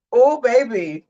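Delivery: ordinary talk, nothing marked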